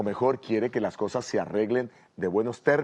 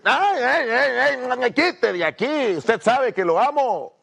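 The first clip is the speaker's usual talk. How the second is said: much higher and louder, a fed-up shrill protest; hammed-up annoyed whine; 11 semitones higher, 5 dB louder, slower than usual. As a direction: push him off with an exaggerated complaining wail. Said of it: "Con las palabras le dijo". (queja exagerada y fastidiada, mucho más aguda y fuerte)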